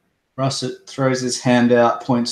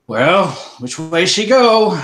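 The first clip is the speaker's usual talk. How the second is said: loud, higher lawman demand; gruff lawman; 8 semitones higher, 7 dB louder than usual. Acted. Russet demanding which way the shooter went, loud. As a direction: snap it like a sheriff on the hunt. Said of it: "Russet will sort of say".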